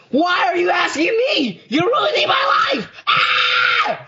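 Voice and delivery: very high screechy tantrum; mock hysterical shriek at Dad; screaming little sister, hammed-up; angry sister impression. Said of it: "She gets so angry".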